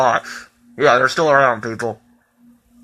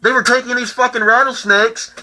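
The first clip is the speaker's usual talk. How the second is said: ordinary talk, nothing marked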